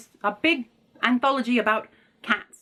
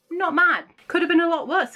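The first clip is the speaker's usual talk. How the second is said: emphatic relief; 8 semitones higher, 3 dB louder than usual. Real, not acted. Reacting to the drawn classic.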